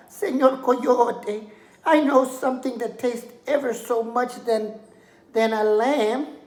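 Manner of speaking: high, pleading little lamb voice; a small lamb talking her way out of danger. Acted. Lamb pleads with the hungry coyote, high and sweet.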